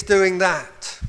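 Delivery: usual speaking voice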